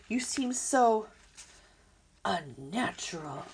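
ordinary delivery

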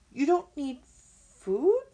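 loud, slow, high disbelief; incredulous. Acted. Fox hearing the robot needs no food, incredulous. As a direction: draw out the question in disbelief.

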